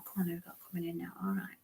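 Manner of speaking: breathy whisper, fast and hushed. Real, not acted. Medium whispering a call to the spirits. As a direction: whisper it quickly under your breath.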